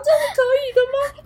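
put-on a little kid shocked at mom's deed; surprised child. 小孩惊讶心声. (小孩子惊讶的高声)

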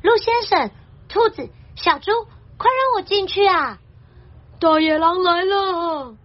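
high, urgent tearful plea; crying bear. Acted. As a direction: beg in a high, whining bear voice. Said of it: "熊熊哭着跑进来说".

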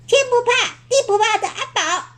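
很高很快的小妖怪尖嗓，带笑; 尖声逗弄地叫名字; 尖尖的声音. Acted. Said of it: "用尖尖的声音叫他". (小妖怪尖着嗓子叫阿宝，带着坏笑)

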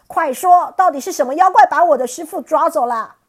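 loud, high furious questioning; angry demand. Acted. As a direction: bark it at the trembling spirits. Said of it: "生气地问".

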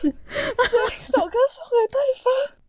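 mock whiny wail, pitched high; fake-cry the line up high.

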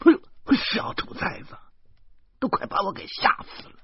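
sudden high yelp dropping to a gasp; startled cry. A narrator's reading. Mother startled by the couple sneaking in, yelping. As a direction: jump with fright, then breathe it out. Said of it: "老妈吓了一跳".

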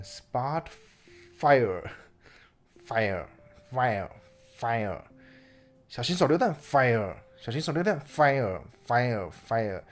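hammed-up battlefield shouting; grenade warning yell. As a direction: yell it like a soldier under fire. Game-style shouting fire, grenade. (像战场上大喊手榴弹警告)

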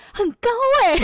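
high, loud, amazed teacher voice; impressed teacher. Retold or imitated. Teacher praising her midterm score, high, loud and amazed. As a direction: play the teacher gushing over a good score. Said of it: "他就说：".

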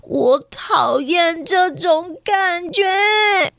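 high, slow, near tears; a frightened child pleading in her head; small scared voice, close to crying; scared little girl. Acted. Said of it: "苏丽在心里想说".

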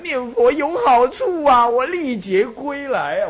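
high, slow, drawn-out announcing; eager proclaiming. Retold or imitated. Telling everyone he met how chanting saved him, slow and eager. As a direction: preach it like a survivor spreading good news. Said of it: "我遇到人就跟人家讲".

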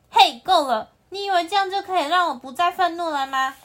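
loud, high angry outburst; furious shout. Acted. The antelope yelling at the lion, furious. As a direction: explode at him, fed up.

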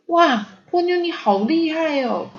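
put-on high, wowed child voice; startled amazement. A narrator's reading. Sosuke startled by Ponyo's feat, amazed. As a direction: exclaim in high-pitched astonishment. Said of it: "中介吓了好大一跳".

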